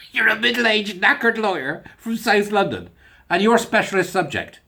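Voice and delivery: high, slow mock quiz-host voice; hammed-up quizmaster in a bad accent; Mastermind quizmaster. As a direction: present the contestant with exaggerated ceremony. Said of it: "terrible accent".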